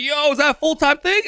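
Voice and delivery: high, eager voice of an amazed mover; high-pitched mover voice in disbelief. Retold or imitated. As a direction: play the stunned, thrilled mover. Mahmoud's amazed reaction retold.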